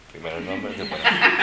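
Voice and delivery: half-laughed, the words breaking up; giggling out the name; laughing. Real, not acted. Laughing while naming the name.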